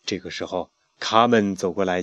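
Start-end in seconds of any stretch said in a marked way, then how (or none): none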